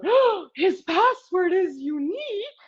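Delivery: high, drawn-out outraged-alien yell; Captain Smek. Retold or imitated. Speaker quotes a favorite movie line, yelling out. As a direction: cry it out high and slow, like a cartoon alien. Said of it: "explains and yells out".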